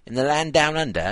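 put-on British accent. Host putting on British for listeners down under, British accent. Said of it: "said that in a British accent".

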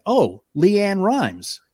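slow, high-pitched Nashville drawl; a hint of a Nashville drawl; Southern man. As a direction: draw it out like a Nashville guy. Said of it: "he went".

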